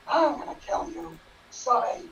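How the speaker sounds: mock villain threat.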